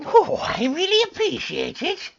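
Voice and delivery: high gnome voice. Acted. Gnome thanking the friend who shares, high voice. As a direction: squeak out thanks.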